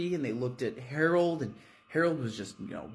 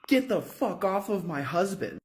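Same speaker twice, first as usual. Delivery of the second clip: feral yell; 7 semitones higher than usual. Acted. Vera screams at the rat.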